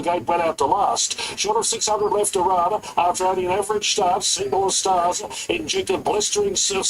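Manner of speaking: building live race commentary; race caller. Acted. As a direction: call the race as the finish nears. Live race call at Eagle Farm.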